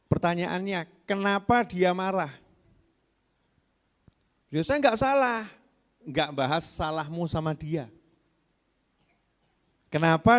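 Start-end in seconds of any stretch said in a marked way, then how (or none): 4.5-5.4 much higher mock defensive reply. Wife insists to the preacher she is not wrong, higher. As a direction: lightly play the indignant wife.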